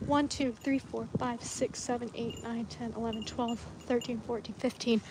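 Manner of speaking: soft, under-breath counting; quiet counting. Real, not acted. Owner counting her goats, soft and under her breath.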